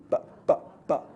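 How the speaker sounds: "but" with lips popped open sharply, no puff of air; French P demo. Shown as a demonstration. Teacher showing students a French P on "but". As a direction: snap the lips apart on "but", unaspirated like French. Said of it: "you'll make a sound like a French P".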